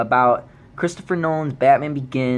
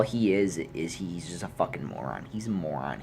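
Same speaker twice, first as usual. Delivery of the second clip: muttered; 7 semitones lower, 9 dB quieter than usual. Real, not acted. Muttered insult before louder repeat.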